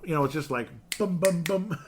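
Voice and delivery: high, loud rhythmic bum bum; sing-song bum bum. Retold or imitated. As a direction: chant it like a button beeping. Imitating pressing a button.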